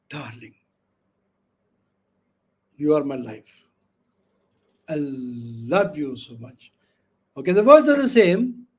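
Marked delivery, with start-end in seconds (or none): 0.1-6.7 polite, loving tone. Shown as a demonstration. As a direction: murmur tenderly to a darling. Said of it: "you have to be very polite".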